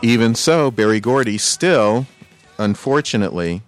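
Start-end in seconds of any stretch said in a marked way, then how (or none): none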